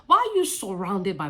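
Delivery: usual speaking voice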